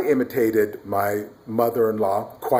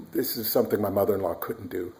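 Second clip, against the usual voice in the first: usual speaking voice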